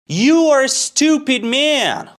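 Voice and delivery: high, loud indignant shout; angry outburst. Acted. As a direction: yell it like a customer in a rage. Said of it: "He's very angry".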